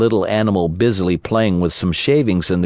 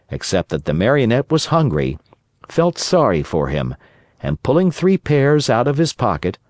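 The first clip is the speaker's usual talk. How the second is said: plain reading voice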